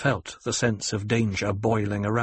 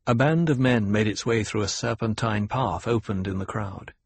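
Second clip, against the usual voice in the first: plain reading voice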